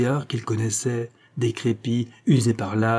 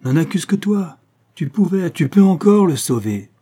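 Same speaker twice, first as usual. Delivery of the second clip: higher, pressing accusation; insistent, raised-pitch urging; urgent pressure; 7 semitones higher, 6 dB louder than usual. A narrator's reading. Duke presses the desperate daughter.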